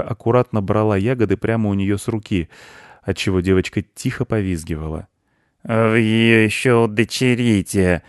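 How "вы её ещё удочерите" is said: slow, creaky, jeering voice; scratchy, disagreeable drawn-out call; unpleasant, creaky. A narrator's reading. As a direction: creak it out nastily and slowly. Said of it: "раздался неприятный скрипучий".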